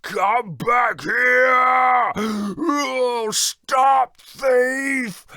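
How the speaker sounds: slow, loud giant roar; giant shouting chase. Acted. Giant chasing Jack down the road, roaring. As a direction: roar after the thief.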